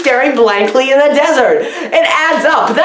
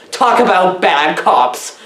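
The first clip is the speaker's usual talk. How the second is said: ordinary delivery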